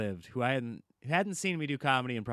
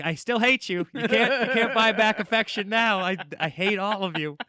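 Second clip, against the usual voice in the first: louder, higher, laughing fake fury; mock outrage; 9 semitones higher, 8 dB louder than usual. [name] mock-raging at Jeff and Larry, loud and laughing. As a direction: yell it with a laugh breaking through. Mock anger at pranking friends.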